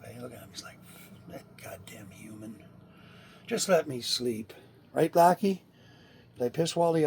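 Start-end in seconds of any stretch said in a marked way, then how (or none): 1.3-4.5 pissed-off cat. Acted. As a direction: grumble under the breath as an annoyed cat. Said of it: "He's pissed off".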